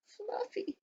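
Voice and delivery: small and timid, barely daring; shy reply. Acted. Porcupine timidly tells the rhino his name, tiny and soft.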